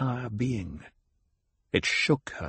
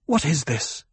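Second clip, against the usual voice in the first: higher-pitched, mildly demanding question; 4 semitones higher than usual. A narrator's reading. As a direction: demand an answer, with restraint. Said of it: "Jessica demanded".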